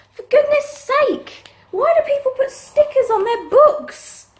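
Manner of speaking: high, loud exasperated complaint; fed up with the sticker; exasperated peeling off a sticker. Real, not acted. Annoyed at book stickers.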